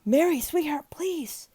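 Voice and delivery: put-on high, anguished old man begging; high desperate plea; desperate pleading. A narrator's reading. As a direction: plead with her to wake up. Old man begging over dying wife.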